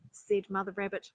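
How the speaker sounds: ordinary delivery, nothing marked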